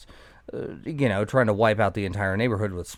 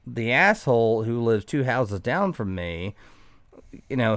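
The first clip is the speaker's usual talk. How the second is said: ordinary talk, nothing marked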